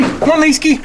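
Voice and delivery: high call to the dog. Real, not acted. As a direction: call the dog across the yard. Calling the dog over.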